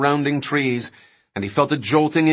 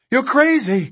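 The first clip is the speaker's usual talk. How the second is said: high, frantic outburst; panicked protest; 12 semitones higher, 3 dB louder than usual. Acted. King protests the reckless driving, high and panicked.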